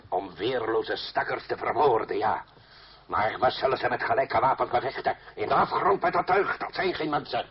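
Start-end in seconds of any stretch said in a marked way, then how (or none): 5.3-7.4 a higher, heated cry of contempt; raging against the noble killers; angry outburst. Acted. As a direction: spit it out in disgust. Danton raging at noble duellists.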